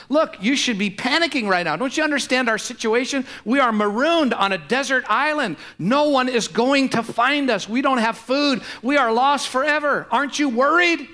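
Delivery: hammed-up exasperation; irritated castaway. Panicked castaway scolding his calm friend, exasperated. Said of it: "that starts to irritate the first guy".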